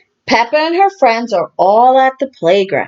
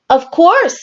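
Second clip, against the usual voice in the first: cheerful high character voice; jolly dad voice; Daddy Pig agreeing; 8 semitones higher than usual. A narrator's reading. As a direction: say it jolly and eager.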